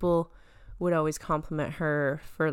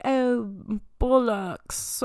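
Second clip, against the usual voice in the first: hammed-up, mocking British accent, pitched higher; bad British accent; 5 semitones higher, 4 dB louder, much slower than usual. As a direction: put on a terrible British accent, loudly lamenting. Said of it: "that British accent is so bad".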